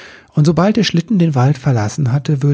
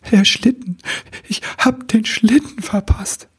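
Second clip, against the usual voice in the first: upset cry; 9 semitones higher than usual. Acted. Elf despairs over missed sleigh.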